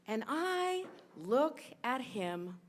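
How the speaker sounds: normal talking voice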